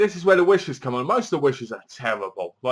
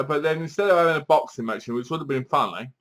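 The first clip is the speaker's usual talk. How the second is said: ordinary talk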